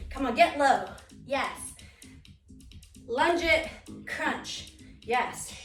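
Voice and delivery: loud, high energetic workout cues; aerobics instructor. Real, not acted. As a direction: pump the class up, shouting encouragement. Pushing through the last reps.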